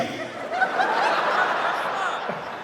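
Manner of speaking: squealing high laugh. Real, not acted. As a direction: shriek with laughter. Laughing at the shirt.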